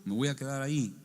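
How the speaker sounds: mock bravado of a sure follower; cocky confidence. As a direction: toss it off full of self-assurance. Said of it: "La otra vez bien campante".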